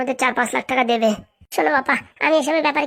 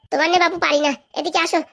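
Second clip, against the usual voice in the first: usual speaking voice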